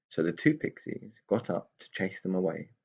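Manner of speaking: plain reading voice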